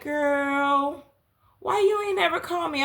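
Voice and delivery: high, playful "why you ain't never call me"; mock-scold her for not calling; teasing friend. Kat on FaceTime to narrator, high teasing complaint.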